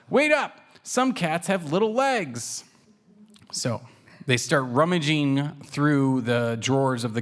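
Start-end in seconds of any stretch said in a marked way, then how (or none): 0.1-2.6 Waffles. A narrator's reading. Little cat chasing his sister, calling out high. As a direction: call out high after her. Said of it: "Waffles says".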